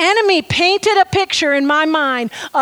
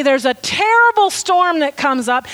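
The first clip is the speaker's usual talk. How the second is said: ordinary talk, nothing marked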